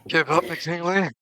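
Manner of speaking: loud, slow in-character shout; opponent's drawn-out yell; shouted taunt. Acted. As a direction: bellow it slowly at a beaten opponent. Game master voices the opponent's taunt.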